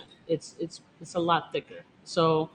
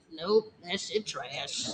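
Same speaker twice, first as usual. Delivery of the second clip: laughing grandma verdict; 10 semitones higher, 3 dB louder, much slower than usual. Pretend. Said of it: "And I'm gonna be like".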